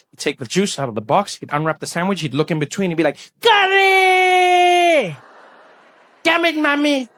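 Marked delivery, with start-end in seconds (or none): 3.4-5.2 long, high, loud outraged yell; mock schoolboy scream of dismay; screaming Thiessen. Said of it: "he'd scream at the top of his lungs". 6.2-7.1 high, exasperated schoolboy cry; high-pitched mock outburst; screaming Thiessen. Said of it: "he'd scream at the top of his lungs".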